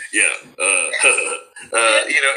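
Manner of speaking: low, wheezy chuckle; Seth Rogen laugh. Retold or imitated. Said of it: "it's all about the laugh".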